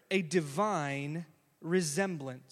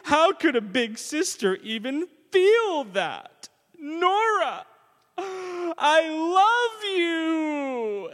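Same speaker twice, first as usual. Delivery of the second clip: high, loud, slow, crying protest of love; big sister sobbing to her baby sister, hammed-up; tearful big sister; 8 semitones higher, 7 dB louder, slower than usual. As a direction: plead through tears like a heartbroken big sister. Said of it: "looks at her baby sister and goes".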